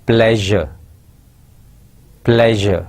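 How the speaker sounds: پلیزر said twice with the zh sound, not like player; correct pronunciation. Shown as a demonstration. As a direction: model پلیزر carefully with zh in the middle. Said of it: "صحیح pronunciation اس کی جو ہے وہ ہے".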